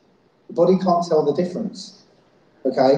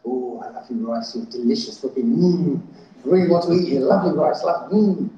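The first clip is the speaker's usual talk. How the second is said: cooing at rice; 3 semitones lower than usual. Retold or imitated. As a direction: coo lovingly like to a pet. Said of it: "jar and go".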